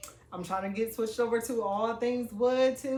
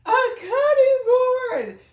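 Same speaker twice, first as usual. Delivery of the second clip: high squealing cry of delight, much louder; shrieking with joy; 14 semitones higher, 11 dB louder, slower than usual. Real, not acted. Unwrapping her sister's gift, she shrieks with joy. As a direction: let it burst out as a delighted scream.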